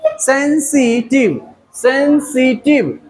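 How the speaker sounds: Sensitive slowly in three beats, सें, सि, टिव; split into syllables. Shown as a demonstration. Teacher counting three syllables in sensitive, split apart. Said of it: "three syllable".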